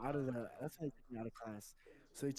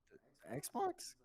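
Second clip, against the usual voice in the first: very high, slow puzzled question; confused question; slower than usual. Retold or imitated. Student answering the principal, unsure and baffled. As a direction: drag the word out, baffled. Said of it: "I was like".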